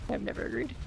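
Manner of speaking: muttered whisper aside; whispered repeat. Real, not acted. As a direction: whisper it to yourself. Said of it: "repeated as whisper".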